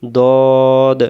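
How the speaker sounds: "দদ" as one long, round "dawd"; "দদ" drawn out without any W glide; rounded-mouth pronunciation. Shown as a demonstration. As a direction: say "দদ" with rounded lips held through the vowel. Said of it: "মুখটা গোল হবে".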